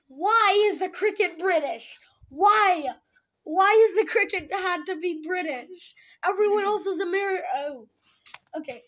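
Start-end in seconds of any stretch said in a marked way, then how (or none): 0.1-7.4 exasperated. Real, not acted. Exasperated rant about cricket.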